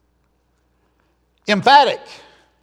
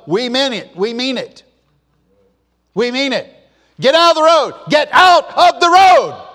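shouted police command, much higher and louder; forceful command; 9 semitones higher, 9 dB louder than usual. Retold or imitated. Preacher imitating police clearing the road, forceful shouting. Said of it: "they've got to come across forceful".

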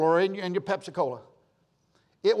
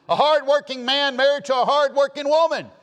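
much higher and louder, preaching with fire; rising preacher shout; 9 semitones higher, 7 dB louder than usual. Real, not acted. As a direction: lift it into a full pulpit cry.

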